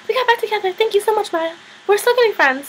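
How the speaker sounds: gushing mock excitement. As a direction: gush it in a put-on high voice. Reunited couple thanking Maya, retold.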